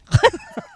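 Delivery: short, high, loud laugh. Real, not acted. Laughing at the loo prompt, short high loud laugh. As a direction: burst out laughing briefly.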